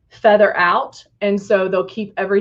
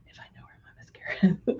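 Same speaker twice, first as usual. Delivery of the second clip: whispering; 10 dB quieter, much faster than usual. Real, not acted. Muttered aside while searching.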